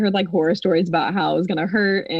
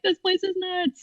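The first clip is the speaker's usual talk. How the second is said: baffled patients muttering; high slow bewildered aside; bewildered onlooker; 13 semitones higher than usual. Retold or imitated. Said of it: "People in the waiting room are like".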